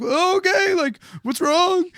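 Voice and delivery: high, slow alarmed question; a touch of panicked confusion; startled nervous. Retold or imitated. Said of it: "We're like".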